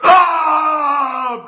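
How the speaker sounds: high, loud mock scream; long yell hurled back; mom's screamed retort. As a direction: scream back without words. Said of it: "And then your mom goes".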